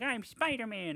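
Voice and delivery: high, put-on small boy's voice; high-pitched mock childish boast; little boy playing hero. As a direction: play a kid pretending to be a superhero. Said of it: "when you were a little boy".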